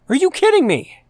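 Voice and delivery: high, loud cry of disbelief with a hard stress on kidding; outraged yell. Real, not acted. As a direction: yell "kidding" at the top of your voice. Ranting about the ship finale.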